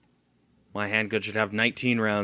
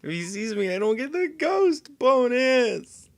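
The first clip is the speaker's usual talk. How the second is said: tense worry; 14 semitones higher, 4 dB louder than usual. Real, not acted. Stealth game, fearing being spotted.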